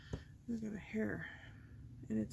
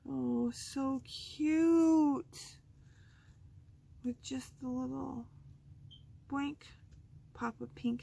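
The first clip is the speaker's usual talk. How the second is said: higher, louder, very drawn out; delighted gush; 6 semitones higher, 6 dB louder, slower than usual. Real, not acted. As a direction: gush over something adorable. Delighted at finished card.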